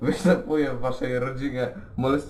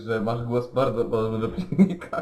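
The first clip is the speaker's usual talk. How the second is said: ordinary delivery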